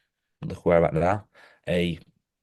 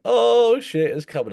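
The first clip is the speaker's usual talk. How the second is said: higher, louder startled cry of oh; mock alarm; 5 dB louder, slower than usual. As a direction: act out a sudden scare at the ride's drop. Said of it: "and then you're like".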